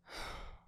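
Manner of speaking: quiet, breathy sigh; gentle exhaled sigh. Acted. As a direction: let a soft breath out. Partner sees patient slumped on bed.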